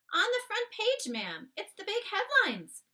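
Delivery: high, loud anxious maid; blurting the dreadful news; frightened maid. Acted.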